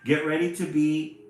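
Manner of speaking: loud, high heroic threat; bold threat. Acted. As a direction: challenge the monster with gusto. Bo threatens the beast.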